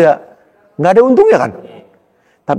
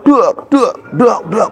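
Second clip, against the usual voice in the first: imitated punch sounds; 9 semitones higher, much slower than usual.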